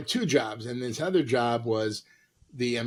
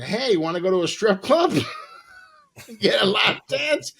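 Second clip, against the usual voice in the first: louder, higher hammed-up pitch; mock come-on; 6 semitones higher, 4 dB louder than usual. To his date in the car, hammed-up invitation. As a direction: oversell it with a laugh. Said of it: "I go".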